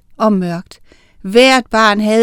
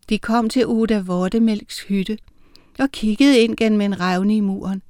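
ordinary delivery, nothing marked

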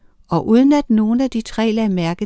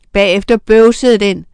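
plain reading voice

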